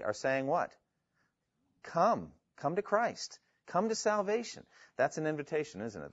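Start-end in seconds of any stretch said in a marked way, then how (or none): 1.8-4.6 high, gentle beckoning call; appealing. Acted. Spirit and church inviting people to Christ, appealing. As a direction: reach out with each phrase, higher pitched. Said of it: "There's a sense of that appeal".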